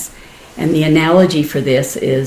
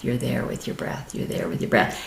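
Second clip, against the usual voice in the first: softer each time until only breath; settling into stillness, hushed; fading to a whisper; 3 semitones lower, 10 dB quieter than usual. Shown as a demonstration. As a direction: let the words dissolve into a whisper.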